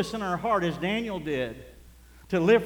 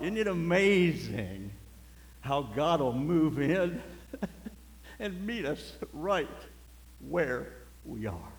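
slow wonder; slower than usual. Real, not acted. Slow reflective aside.

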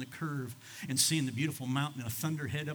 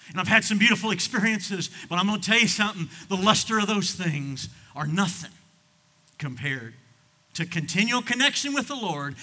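loud, high, fast preaching push; full-force preaching; 6 semitones higher, 8 dB louder than usual. Acted. Pastor testifying to the congregation, loud preaching. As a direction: declare it over the crowd.

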